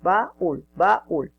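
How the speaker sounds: Baúl split into ba and úl, said twice; two separated syllables, ba, then úl. Shown as a demonstration. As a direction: break Baúl in two, firm on each beat. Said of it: "por ba y por ul".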